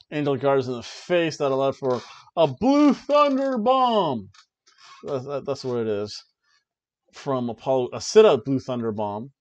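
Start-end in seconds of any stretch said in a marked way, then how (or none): 2.4-4.3 loud, high, drawn-out wrestling shout; stretched-out excited shout; hyped move call. Acted. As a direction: call the big move like a ring commentator.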